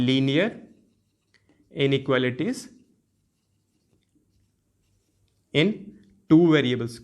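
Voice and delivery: spaced out with long pauses, rising mid-phrase; slow dictation. Shown as a demonstration. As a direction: dictate it piece by piece for note-taking. Teacher dictates the term being written. (रुक-रुककर लिखवाते हुए बोलना)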